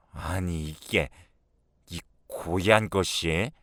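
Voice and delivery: understated acted outrage; outraged mother-in-law. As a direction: scold an insolent daughter-in-law, slowly.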